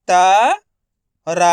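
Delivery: very slow, every sound drawn out; slowly stretched. Shown as a demonstration. Teacher to pupils learning spelling, drawn out very slowly. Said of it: "teacher stretches word for pupils".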